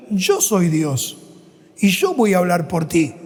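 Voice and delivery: low, slow, measured divine reply; lightly voiced God. God answering Jeremiah's excuses, low and slow.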